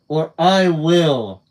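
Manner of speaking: slow, loud stern threat; as severe as he can manage. Acted. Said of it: "he said as severely as he could".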